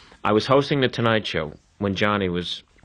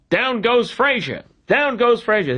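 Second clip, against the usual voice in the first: much higher, slow boxing-call bellow; Howard Cosell call; 11 semitones higher than usual. Retold or imitated. Host imitating the sportscaster's famous fight call, slow and high. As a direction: stretch it out like a ringside call. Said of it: "He then launches into".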